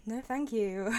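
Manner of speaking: ordinary delivery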